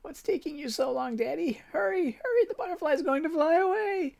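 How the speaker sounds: put-on high, eager child voice; a small girl urging her father on; high excited child; little girl calling Daddy. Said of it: "reliving memories of early childhood".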